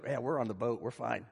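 low, soft, unbothered shrug of a voice; acted laid-back murmur; complacent aside. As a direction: play contented onlookers, hushed and low. Acting disciples content to stay put.

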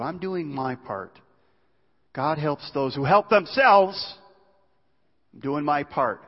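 slow, loud, smug insistence; proud and sure of your own effort; self-righteous boast. Acted. Self-righteous brother boasting before God, slow and smug.

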